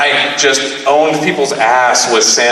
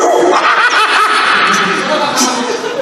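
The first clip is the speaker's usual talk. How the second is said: long high-pitched laugh. Real, not acted. Comedian loving his own story, long high laugh.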